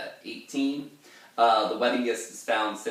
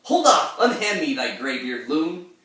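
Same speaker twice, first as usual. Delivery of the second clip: much higher, loud indignant cry; young man shouting; 7 semitones higher, 10 dB louder than usual. Acted. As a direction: shout him off in outrage. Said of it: "the young man replies".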